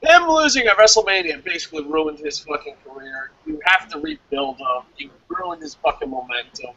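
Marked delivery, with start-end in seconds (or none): none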